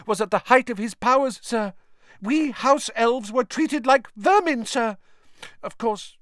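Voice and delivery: high, loud, pleading elf voice; a humble house elf, high and eager; high squeaky house elf. Acted. Dobby telling Harry of the dark days, in a high elf voice.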